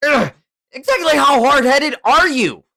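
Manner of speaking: high, loud frustrated yell; exasperated shout. Acted. Hothead fed up with stubborn elder, exasperated high shout. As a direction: explode at his stubbornness. Acted quarrel in story.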